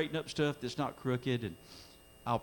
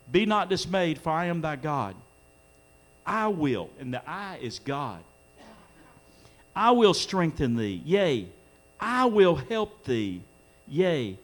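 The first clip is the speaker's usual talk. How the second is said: raised pitch with punched, louder key phrases; fervent preaching; 6 semitones higher, 4 dB louder than usual. Real, not acted. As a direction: preach urgently to the congregation. Preaching at fuller force.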